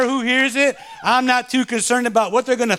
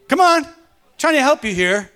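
ordinary delivery

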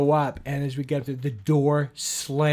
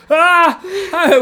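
sudden high, loud shriek of fright; startled scream; 12 dB louder than usual. Retold or imitated. Seeing a face at the frosted window, screaming. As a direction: jump out of your skin at the face. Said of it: "I go".

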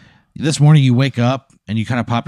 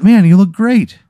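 delighted compliment; 11 semitones higher, 5 dB louder, slower than usual. Acted. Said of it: "And he goes".

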